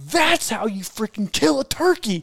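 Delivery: high, drawn-out exclamation; retold triumphant yell. Guide jumping up after the kill, high triumphant exclamation. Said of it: "and I jump up".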